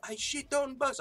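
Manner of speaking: high mock chant of a fan; lightly voiced heckler; heckling fan. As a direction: echo the jeer from the stands. Quoting crowd heckle again.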